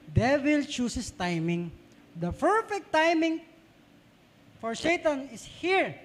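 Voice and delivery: slow, weighty preaching of each word; slow emphatic warning. Acted. Preacher to congregation, slow grave warning. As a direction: lean on every word like a warning. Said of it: "preacher slows for emphasis".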